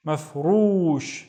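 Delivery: "mafrush" with the ru drawn out; "mafrush" said slowly, second syllable held long. Shown as a demonstration. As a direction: stretch the u in "mafrush" to double length. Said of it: "The ru is twice as long".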